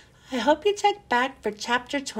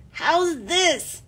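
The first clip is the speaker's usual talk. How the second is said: gasping; 5 semitones higher than usual. A narrator's reading. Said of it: "he gasped".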